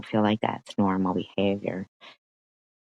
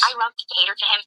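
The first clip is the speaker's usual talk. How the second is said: mocking imitation; 9 semitones higher, much faster than usual. Streamer mimicking the caller's proud wife, high and mocking. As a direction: ham up her smug words, pitched way up.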